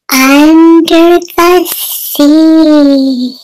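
drawn out title; slow announcement. Acted. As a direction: announce it slowly and grandly. Announcing the lesson theme.

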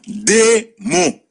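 Satan said high and weighty; sermon-like emphasis; emphatic Satan. Shown as a demonstration. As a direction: hold up the word Satan. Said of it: "ça fait deux syllabes".

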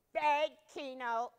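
put-on boy ordering slowly; ordered. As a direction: give the dog a firm order. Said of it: "he ordered".

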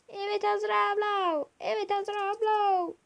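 high squeaky voice. Acted. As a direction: pitch it way up. Mimicking character line.

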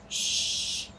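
whispered shush to the dog. Acted. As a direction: hush the dog, breathy. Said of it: "Lottie told Einstein".

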